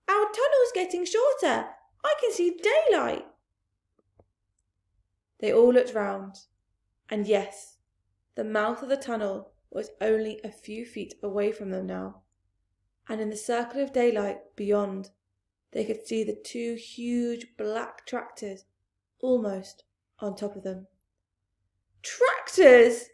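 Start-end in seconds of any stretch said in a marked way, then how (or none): 0.1-3.3 put-on high child fox voice; small fox. Small fox telling the family, high child voice. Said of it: "said one of the small foxes". 22.0-23.1 loud alarmed shout; shouted warning. Acted. Mister Fox warning his family, loud shout. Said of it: "shouted Mister Fox".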